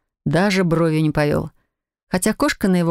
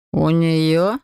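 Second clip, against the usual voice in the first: drawn-out question with a scornful rising lilt; disdainful drawl. A narrator's reading. Mage doubting the wife's potions, disdainful drawl. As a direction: stretch it out dismissively, letting the end climb. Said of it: "пренебрежительно протянул маг".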